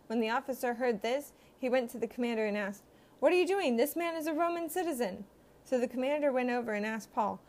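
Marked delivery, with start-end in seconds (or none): 3.2-5.2 alarmed. A narrator's reading. Officer alarmed to commander.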